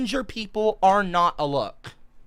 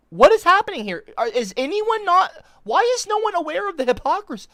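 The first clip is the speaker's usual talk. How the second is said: exasperated disbelief; 9 semitones higher than usual. Real, not acted. Exasperated rant about hypocrisy.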